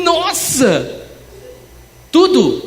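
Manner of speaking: loud, high burst of astonishment; hammed-up shout of amazement; amazed exclamation. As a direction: exclaim it big, thrilled by the windfall. Acts out amazement at the gift.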